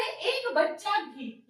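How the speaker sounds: "भी" drawn out and emphasised slowly; slow demo stress on "भी". Shown as a demonstration. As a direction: press on "भी" in एक बच्चा भी. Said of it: "यानी कि एक बच्चा भी".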